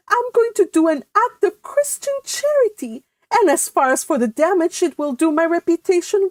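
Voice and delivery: high, light, teasing voice; breezy daughter. Acted. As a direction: brush it off cheerfully, high.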